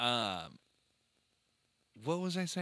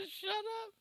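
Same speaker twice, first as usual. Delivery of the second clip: 1 imitating toddler; much faster than usual. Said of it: "Just like".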